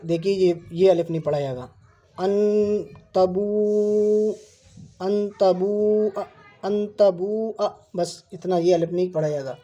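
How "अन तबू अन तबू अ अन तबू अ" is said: अन तबू अ spelled out very slowly, syllable by syllable; slow spelling. Shown as a demonstration. Teacher spells अन तबू अ very slowly for students. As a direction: sound out अन तबू अ slowly for the class. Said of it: "देखो यहाँ पर इन्होंने इस तरह लिख दिया है".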